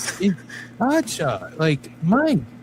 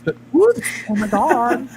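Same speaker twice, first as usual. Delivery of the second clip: mock effeminate voice; 5 semitones higher, 5 dB louder than usual. Continues mocking effeminate voice.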